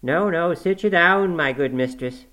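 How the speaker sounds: high, loud fussing housekeeper; urgent reassurance. A narrator's reading. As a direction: hurry to calm a shocked mistress. Housekeeper hushes the shocked nurse.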